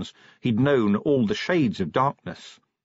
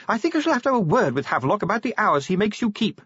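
higher, quicker, genteel wife's resigned voice; a lady sighing about her husband's hours; Lady Sybil, fond wife; 10 semitones higher, faster than usual. A narrator's reading.

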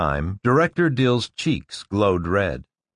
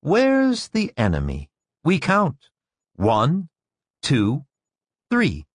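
put-on teacher to children; 5 semitones higher than usual. A narrator's reading. Director leading children in a counting game.

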